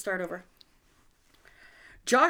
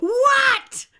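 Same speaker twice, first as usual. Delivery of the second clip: high, loud cartoon screech of "What"; mock yell; 10 dB louder than usual. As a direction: yell it high, as an exasperated bit. Punchline yell in cartoon bit.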